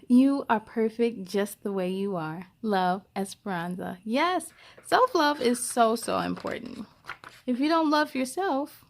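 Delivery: ordinary delivery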